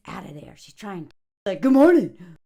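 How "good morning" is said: sudden loud good morning; mock loud greeting; loud startled greeting. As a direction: blurt it out loud.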